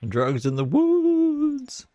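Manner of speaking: squeaky high mocking voice. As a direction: shriek it like a mocking caricature. Mocking the accusers about drugs.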